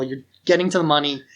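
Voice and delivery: ordinary talk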